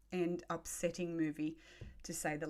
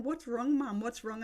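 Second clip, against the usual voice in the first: higher-pitched daughter's questioning; lightly voiced anxious little one; imitating a worried child; 6 semitones higher than usual. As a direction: voice a child asking mum what's up. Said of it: "And she's going".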